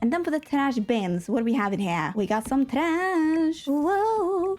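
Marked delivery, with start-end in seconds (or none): 2.2-3.7 very slow, higher, dragged out; deadpan sarcasm. Pretend. Builder finding only trash in the bins, sarcastic. Trash bin item is just trash. 3.7-4.5 high put-on whoa; mock surprise. Builder reacting to the trash, mock surprise.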